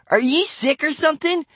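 high-pitched taunting question; scoffing kid voice; incredulous. A narrator's reading. Boy mocking his angry neighbor.

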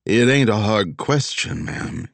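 put-on heavy Philadelphia accent, annoyed; gruff old Philly cop accent. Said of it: "in a heavy Philly accent".

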